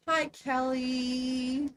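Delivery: usual speaking voice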